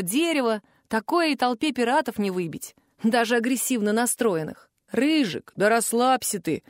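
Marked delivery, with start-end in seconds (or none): none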